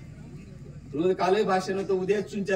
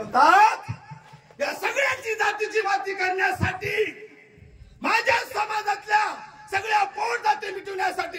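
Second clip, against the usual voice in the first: high, loud proclamation; preaching at full force; 13 semitones higher, 4 dB louder than usual. Acted. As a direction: shout it to the rally. Quoting leader in preaching voice.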